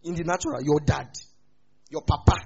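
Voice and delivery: ordinary delivery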